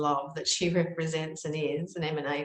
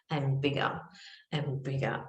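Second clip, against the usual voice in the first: ordinary talk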